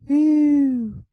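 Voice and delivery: a high, loud squeal of disgust; a sharp, playful cry of disgust. Real, not acted. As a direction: recoil with a shrill ew. Disgusted exclamation.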